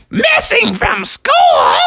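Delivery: very high, drawn-out incredulous question; shocked shriek. Acted. Froggy's mom hears the school's call, shocked shriek. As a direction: squawk it back in horror. Mother gets alarming call.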